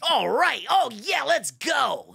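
loud, high mock hollering; excited cheering. Comic imitating his friend living it up in the theater, excited high yelling. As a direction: imitate a fan hyped beyond belief.

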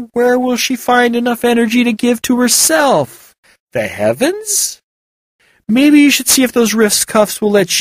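mock the boy's idea with dry sarcasm; Val voiced. A narrator's reading.

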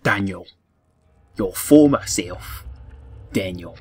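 loud, slow mock Australian Daniel; mock Australian accent. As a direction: boom it out in a hammed-up Australian accent. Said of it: "he went slightly Australian".

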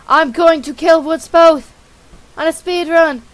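reckless dad voice. Acted. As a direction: shout it back as the mad father. Dad answers alarmed son.

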